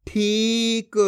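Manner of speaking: ठीक slow with a long ee; drawn-out vowel. Shown as a demonstration. Teacher reads the joined word, ठीक drawn out. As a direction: stretch the ई in ठीक. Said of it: "जिस तरीके से मैं खींच खींच के पढ़ रहा हूँ".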